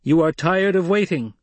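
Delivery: "waiting" falls at the end of a high, loud, carrying line. Acted. Paul addressing the whole cavern crowd, loud and carrying. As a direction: project "You are tired of waiting" to the back wall. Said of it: "spoke in a voice that carried to the farthest corners".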